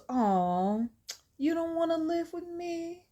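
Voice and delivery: gentle, high-pitched pleading question; acted soft, drawn-out appeal; softly asking. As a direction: coax sweetly, as if a little hurt. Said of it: "Angela softly asks Dennis".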